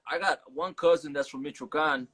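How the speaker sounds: ordinary delivery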